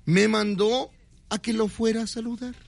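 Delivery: imitated message, pitched well up; insistent messenger. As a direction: repeat the order high and pointed, in two parts. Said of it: "como me dio ese tono".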